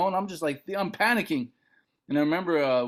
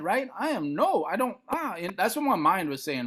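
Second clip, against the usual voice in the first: usual speaking voice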